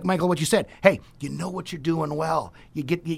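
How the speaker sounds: usual speaking voice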